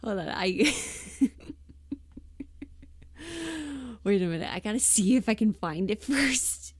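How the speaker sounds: words broken up by real laughter; laughing through words. Real, not acted. Streamer searching for a funny clip, laughing as she talks. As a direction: talk while you can't stop laughing.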